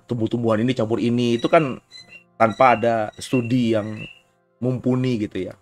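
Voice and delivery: ordinary delivery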